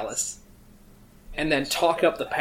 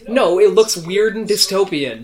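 louder, higher bickering retort; heated objection; 5 semitones higher, 7 dB louder than usual. Pretend. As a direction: shoot the idea down emphatically. Mock quarrel over wall colour.

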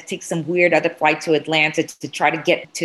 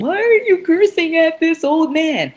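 much higher, louder prissy outrage; mock shocked passengers, far higher and louder; mock scandalized bystanders; 11 semitones higher, 3 dB louder than usual. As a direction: mimic shocked onlookers clutching pearls. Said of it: "everybody around me is getting really".

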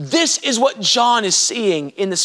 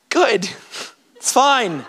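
usual speaking voice

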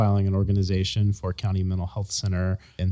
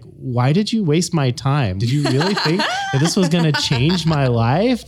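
higher-pitched incredulous complaint, imitated; mock indignation; 5 semitones higher than usual. Imitating employees who felt training wasted time, mock indignation. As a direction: play a put-out trainee demanding an explanation. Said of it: "people who are like".

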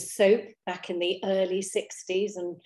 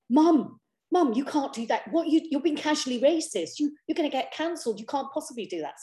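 higher-pitched imitation of protesting kids; scandalised child; 7 semitones higher than usual. Kids telling Mum off for doing accents, mock scandalised. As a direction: tell Mum off, mock-appalled, in a raised pitch. Children scolding her, voiced in story.

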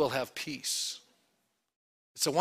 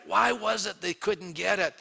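usual speaking voice

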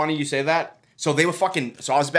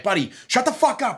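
much higher mock snap at the official; trainer flipping out; 10 semitones higher than usual. Trainer snapping back at the commission official, high mock anger. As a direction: play the furious trainer telling him off. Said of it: "flipping out".

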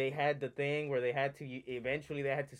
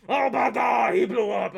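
loud, higher, hammed-up shout of amazement; mock fan shout; 7 semitones higher, 11 dB louder than usual. Mocking how fans will react to the show, loud shout. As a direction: yell it like an overexcited fan.

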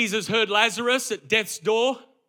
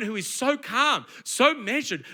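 normal talking voice

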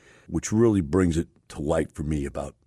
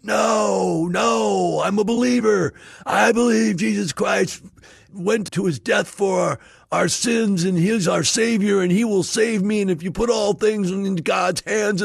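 much higher, louder shouted refusal, slow in places; defiant shouting; 6 dB louder, slower than usual. Retold or imitated. Stranded man shouting back at the sheriff, defiant high yell. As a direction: yell back your faith from the chimney.

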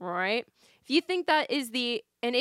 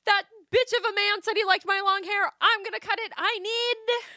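put-on much higher, louder indignant girl voice; mock heroine rant; 11 semitones higher, 5 dB louder than usual. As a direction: ham up her fury about the hair. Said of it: "she's like".

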